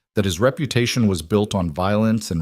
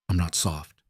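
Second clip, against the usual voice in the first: low and much softer, steady; quiet; 3 semitones lower, 7 dB quieter than usual. A narrator's reading. Husband to his foreman, quiet and cold. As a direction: say it quietly, with menace held back. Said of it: "Jace said quietly".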